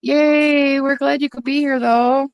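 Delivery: high cheery yay; bright high-pitched welcome, bubbly; excited welcome. Real, not acted. Welcoming a viewer to the stream, high and excited.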